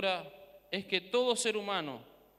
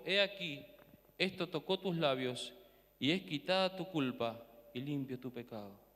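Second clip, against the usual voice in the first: soft and hushed; 6 semitones lower, 5 dB quieter than usual. Acted. Angel's words softly spoken.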